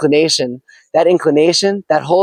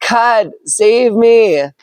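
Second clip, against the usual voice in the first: very slow, drawn-out higher plea; desperate plea; 6 semitones higher, much slower than usual. Acted. Drowning man begs God one last time, slow and desperate. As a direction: stretch out every word in desperation. Water at his neck, pleads.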